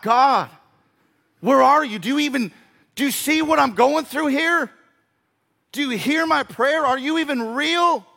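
high, loud desperate questioning; crying out. Acted. A hurting believer asks God where he is, desperate cry. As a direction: hurl the questions at God in anguish. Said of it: "When you're crying out".

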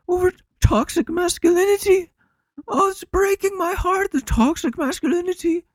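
mock-weepy, very high-pitched impression; Jordan Peterson. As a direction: send him up, tearfully lamenting way up high. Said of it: "crying over".

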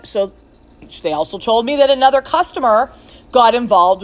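usual speaking voice

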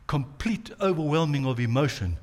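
ordinary talk, nothing marked

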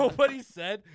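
nobody said, yelled out loud and high; loud outraged shout. Real, not acted. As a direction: blow up into a loud yell. Heated rant about smoking.

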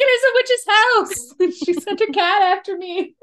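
put-on high, loud panicked kid voice; scared kid voice. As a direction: squeal it like a frightened kid. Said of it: "They'd be like".

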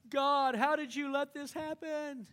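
a fool blaming God for his own mess; mock whining prayer. Mocking angry complaint to God.

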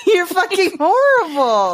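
high, drawn-out laughing outrage; laughing mock scolding. Real, not acted. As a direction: scold a friend through helpless laughter, stretching it out.

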